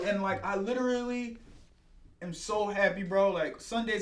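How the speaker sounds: ordinary talk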